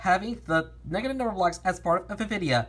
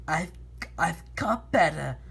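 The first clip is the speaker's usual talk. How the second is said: villain voice. Acted. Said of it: "Negative Infinity simply said".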